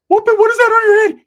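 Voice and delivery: high-pitched screaming character, very loud; Ricky's outraged shriek. Acted. Ricky yelling about a hat.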